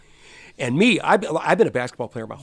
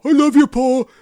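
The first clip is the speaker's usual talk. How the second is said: much higher, louder gushing [name]; [name] impression; 12 dB louder than usual. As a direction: gush like a starstruck fan. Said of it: "[name] looking at Paul".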